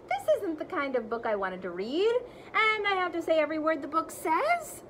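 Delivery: lively, animated read-aloud; reading to children. Acted. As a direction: read it big for the kids. Teacher reads the book aloud expressively.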